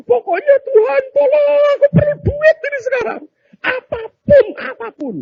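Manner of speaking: loud, very high mock wailing plea; desperate prayer. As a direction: beg God frantically, hammed-up. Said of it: "Begitu kesusahan". (pura-pura meratap memohon kepada Tuhan)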